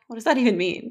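normal talking voice

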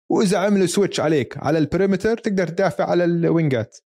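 ordinary delivery

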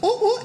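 high mock alarm wail; alarm siren imitation. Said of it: "It goes".

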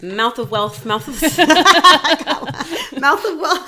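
giggling, tripping over the name; laughing fit over a tongue twister; laughing through it. Real, not acted. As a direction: try to say it but keep cracking up. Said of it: "I fucking hate his name".

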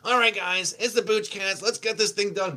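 high, loud projecting co-host impression; imitating booming Vinny. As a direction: carry it through the wall, mimicking your co-host. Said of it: "his voice echoes through the wall".

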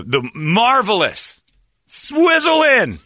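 high, drawn-out fanfare; hyped-up, stretched-out introduction; mock-grand announcement. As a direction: sing out the name like a showman. Grandly announcing the bar's name.

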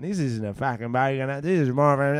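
lightly put-on Australian bogan accent; Aussie bogan accent, played up for demonstration. Shown as a demonstration. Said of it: "You put on an accent".

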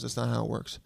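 quoting an officer, low and soft; flat deadpan comeback, dropped in quietly. Retold or imitated. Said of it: "And he deadpan".

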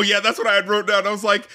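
normal talking voice

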